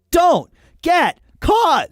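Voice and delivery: high, slow, shouted slogan; loud dramatic shout. Acted. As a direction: proclaim it like a battle cry. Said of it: "your shirt says something".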